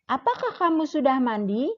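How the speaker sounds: "Apakah kamu sudah mandi?" asked quickly, pitch climbing to the end; rising question intonation. Shown as a demonstration. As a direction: ask it brightly and let the voice go up on "mandi". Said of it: "yang menggunakan intonasi naik". (intonasi naik di akhir, "mandi" diucapkan meninggi)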